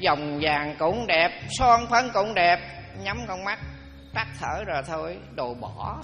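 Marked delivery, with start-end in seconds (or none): 0.1-2.6 slow, drawn-out listing; listing the body's adornments, slow and deliberate. Real, not acted. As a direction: spell out each finery slowly. Sermon listing adornments slowly.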